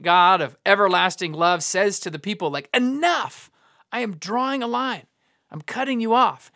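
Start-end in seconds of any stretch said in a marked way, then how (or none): 2.7-6.5 raised pitch, emphatic and final; firm cutoff. Acted. Preacher voicing God fed up with his people, firm cutoff. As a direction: put your foot down, pausing between declarations. God voiced drawing a line.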